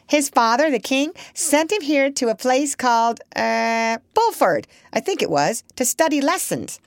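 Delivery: put-on chatty old parrot, prim and knowing. Parrot recounts.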